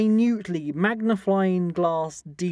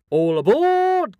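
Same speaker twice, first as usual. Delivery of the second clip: slow, high, loud all aboard; train conductor call; 10 semitones higher, 6 dB louder, much slower than usual. Pretend. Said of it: "sign-off catchphrase".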